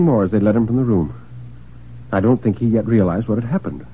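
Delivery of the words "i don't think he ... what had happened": ordinary delivery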